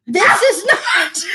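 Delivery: slow words breaking into laughter; laughing. Real, not acted. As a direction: say it while cracking up. Joke about her hair.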